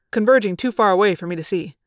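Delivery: ordinary delivery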